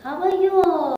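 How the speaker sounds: ordinary delivery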